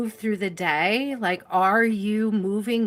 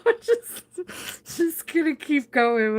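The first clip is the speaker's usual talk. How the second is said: higher, laughing through the words; 7 semitones higher, slower than usual. Real, not acted. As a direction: giggle while you talk. Laughing at own long list.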